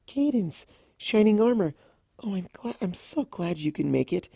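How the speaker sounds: shy hostess greeting royal guests; gentle Fluttershy. Acted. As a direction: stumble sweetly over the welcome.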